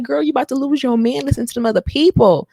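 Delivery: put-on fast, high, loud warning to a girlfriend; animated, exasperated talking-to; sassy scolding. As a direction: tell your girl off playfully. Retelling her scolding of Hannah.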